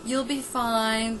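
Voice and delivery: put-on hushed, tender old-school mother; soothing sixties mother. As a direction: coo it like a kind old-fashioned mom. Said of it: "if this was a mother in the nineteen sixties".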